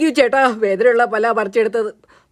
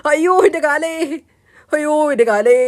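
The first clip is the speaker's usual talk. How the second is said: hammed-up wail over a hurt leg; crying in pain; 4 semitones higher than usual. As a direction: wail it out, stretching the last vowel. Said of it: "മോൻ കരയണ്ട". (വേദന കൊണ്ട് നിലവിളിച്ച്)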